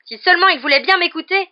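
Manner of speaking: high, loud furious retort. A narrator's reading. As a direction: snap back in outrage. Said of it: "répliqua Harry furieux". (réplique furieuse)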